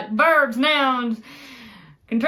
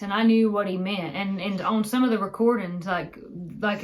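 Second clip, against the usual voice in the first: ordinary talk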